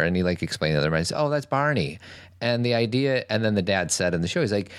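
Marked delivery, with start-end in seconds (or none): 1.2-2.0 higher, offhand mock dad; dad's casual punchline. Father casually naming the closet monster, higher and offhand. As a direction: toss it off like it's nothing.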